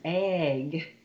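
"egg" drawn out slowly; long, slow "egg"; stretched word. Shown as a demonstration. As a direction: hold the vowel of "egg" for a beginner. Teacher models stretched practice word.

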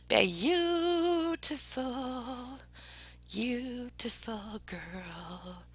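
very slow, stretched-out gushing; drawn-out admiration. Real, not acted. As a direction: linger on every vowel as you admire. Gushing over a sent picture.

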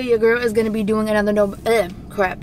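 talking with a dry mouth; words sticking in a dry mouth. Real, not acted. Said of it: "my mouth is so dry right now".

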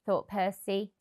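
plain reading voice

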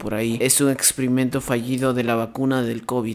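lightly hammed-up hushed documentary voice; mock documentary narration. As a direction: narrate the creature like a wildlife host. Mock nature documentary commentary.

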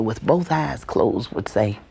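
usual speaking voice

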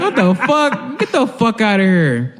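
high, laughing incredulous outburst; laughing disbelief. Real, not acted. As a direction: crack up while dismissing the whole idea. Laughing disbelief at own joke.

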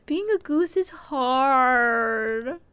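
very slow, drawn-out complaint; mock lament. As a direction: moan it out dramatically. Lament about game.

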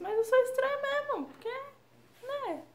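high, amused self-admission; playful admission. Real, not acted. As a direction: own it with a laugh in the voice. Admite com humor. (admissão brincalhona e aguda)

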